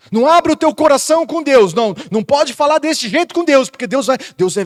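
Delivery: hammed-up voice of fearful religion; mock scolding religion. Pastor imitating religion threatening the believer, mock scolding. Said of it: "A religião também diz que".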